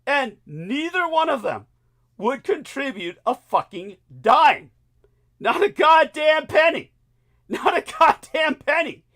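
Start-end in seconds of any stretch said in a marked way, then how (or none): 1.1-9.0 shouting, pitch way up; angry shout. Real, not acted. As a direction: yell it in outrage. Angry rant at two hosts.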